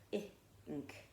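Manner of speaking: "ink" split into a short ih, then nk; short I sounded out. Shown as a demonstration. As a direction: draw out "ink" in two parts, ih and nk. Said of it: "it's a short I sound".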